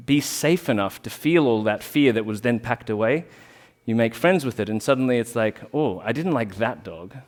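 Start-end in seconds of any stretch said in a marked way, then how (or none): none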